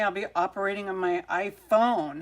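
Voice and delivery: normal talking voice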